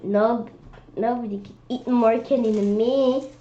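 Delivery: plain reading voice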